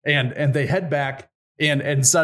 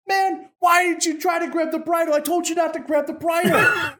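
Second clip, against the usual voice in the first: exasperated scolding. Acted. Grey Wolf scolding Ivan over the bridle, high and exasperated. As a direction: scold him in disbelief, pitched up.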